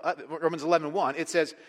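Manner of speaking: usual speaking voice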